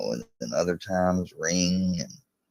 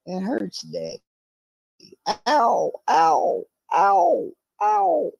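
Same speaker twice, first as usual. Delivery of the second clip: much higher, louder pretend squeals of pain; mock pained squeal; 4 dB louder, slower than usual. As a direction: squeal ow like a hurt pup. Pretend hurt cry to dog.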